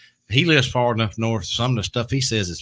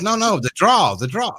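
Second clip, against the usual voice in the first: put-on insisting Maine accent; 9 semitones higher, 5 dB louder than usual. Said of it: "He goes".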